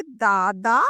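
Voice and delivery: दादा drawn out in two long syllables; sounded out slowly. Shown as a demonstration. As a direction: stretch each दा of दादा for learners. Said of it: "इस तरह से समझेंगे". (दादा को खींचकर, धीरे बोलना)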